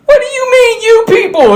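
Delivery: very high, outraged retort; high-pitched mock offence; offended movie character. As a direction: shoot way up in indignation. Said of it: "Al Pacino there, or whatever his name was in the movie, is like".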